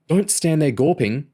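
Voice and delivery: hurried, pressing warning; urgent. A narrator's reading. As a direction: urge him to move now. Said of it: "he said urgently".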